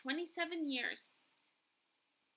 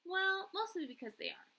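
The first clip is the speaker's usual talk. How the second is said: ordinary delivery, nothing marked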